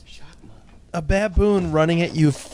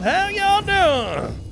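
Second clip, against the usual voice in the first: high-pitched hammed-up southern greeting; southern accent. Joking that Jerry is from southern Canada, mock southern accent. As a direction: put on a broad mock southern drawl. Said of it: "southern part of Canada where they talk like that".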